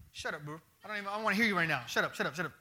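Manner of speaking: low, dismissive and fast God voice; God brushing off the devil. Acted.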